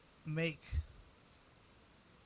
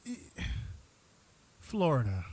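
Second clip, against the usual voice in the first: dramatic announcement. Real, not acted. Dramatic announcement of segment topic.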